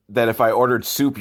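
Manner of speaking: usual speaking voice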